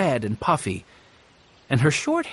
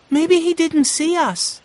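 put-on much higher, light girl's voice, puzzled; young girl Violet; 14 semitones higher, 4 dB louder than usual. A narrator's reading. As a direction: voice shy Violet, a bit uneasy. Violet's voice in narration.

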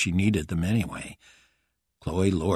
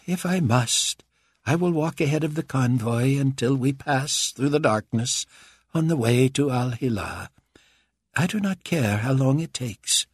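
higher, haughty ruler voice; Carpathia voice; 7 semitones higher than usual. A narrator's reading. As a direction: speak like a proud tyrant. Carpathia speaks.

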